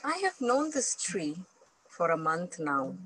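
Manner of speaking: ordinary delivery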